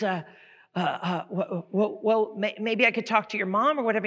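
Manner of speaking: high-pitched, fumbling salesman; flustered salesman. Acted. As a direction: grope for the next question, flustered. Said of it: "there's a salesman on there".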